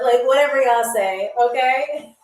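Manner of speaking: ordinary talk, nothing marked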